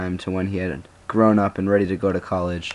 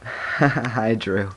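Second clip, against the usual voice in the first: shy, smiling; 3 semitones higher than usual. A narrator's reading. Boy greeting his crush, smiling and shying away. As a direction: giggle bashfully, then say it smiling. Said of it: "Alex said, smiling and shying away".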